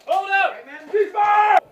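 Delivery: high urgent shouting; shouting cease fire. Real, not acted. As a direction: yell to stop everyone firing. Stopping fire after injury.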